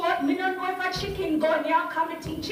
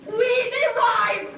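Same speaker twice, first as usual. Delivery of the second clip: "We derive" called out louder, slow; emphatic call; 5 semitones higher, 5 dB louder, slower than usual. Shown as a demonstration. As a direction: drill it for the class to repeat. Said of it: "say it".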